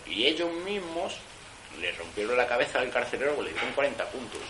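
ordinary delivery

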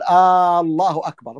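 آه الله أكبر with a long drawn-out opening "aa"; stretched first vowel. Shown as a demonstration. Teacher showing students the forbidden lengthening of الله, long opening vowel. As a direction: drag the opening hamza of الله into a long "aaa". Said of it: "ولا يمد لفظ الجلالة، لا يقول".

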